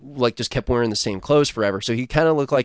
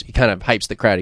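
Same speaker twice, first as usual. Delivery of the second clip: normal talking voice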